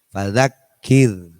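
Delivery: fadzakir slow with a thin R. Shown as a demonstration. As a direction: say fadzakir lightly at the R. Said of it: "dibaca tipis".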